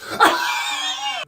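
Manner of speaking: high, hacking cough burst; violent coughing. Acted. Sick boy downplaying his cough, coughing hard. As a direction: let a big cough rip. Coughs after saying relax.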